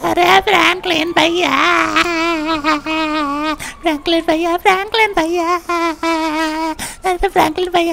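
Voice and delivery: mock wailing call for big brother; crying. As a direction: sob out the name, dragging the last vowel. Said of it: "इतना रो क्यों रहा है".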